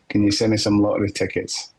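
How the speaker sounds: ordinary talk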